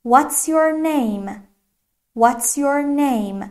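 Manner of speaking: very slow, drawn-out English question; careful teacher's stretched-out demonstration; slow model question. Shown as a demonstration. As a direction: model the question slowly for learners.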